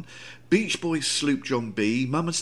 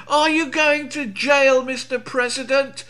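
much higher, louder reporter shout; shouted question; 13 semitones higher, 5 dB louder than usual. Retold or imitated. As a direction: yell it across the barrier. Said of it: "journalists shouting out questions".

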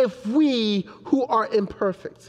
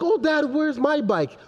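higher-pitched imitation of a child; little daughter asking; 7 semitones higher than usual. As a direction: mimic a small girl questioning Dad. Father voices his little daughter asking.

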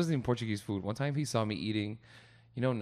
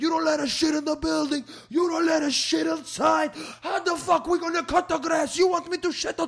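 furious high yelling rant; snapping at the landlord; 9 dB louder than usual. Retold or imitated. Landscaper yelling at the landlord who banned him, furious shout. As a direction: yell it like a worker pushed too far. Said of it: "the guy snapped".

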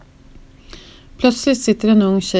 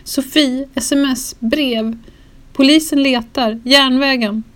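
higher, strained words between sobs; retold weeping, pitched up; imitating sobbing caller; 6 semitones higher, 4 dB louder than usual. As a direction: blurt the fragments like a friend choking on tears. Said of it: "Titti storgråter och hulkar".

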